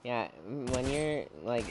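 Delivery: ordinary delivery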